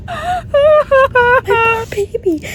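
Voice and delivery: high, loud, drawn-out cry; tearful wail. Real, not acted. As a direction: wail it out slowly in sympathy. Moved to tears by the book.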